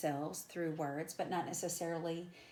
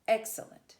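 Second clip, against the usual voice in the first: EX-cellent, first syllable of Excellent hit high and loud; dactyl stress; 4 semitones higher, 8 dB louder than usual. Shown as a demonstration. Teacher demonstrating a dactyl, Excellent stressed hard on "Ex". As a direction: punch "Ex" in Excellent, two light syllables after. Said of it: "It's stressed, unstressed, unstressed".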